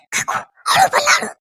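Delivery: high, whispered shushing; urgent hush. Acted. Daughter-in-law hushing gossiping aunt near husband, urgent whisper. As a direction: hush her so the house won't hear. Hushes visitor, family is home.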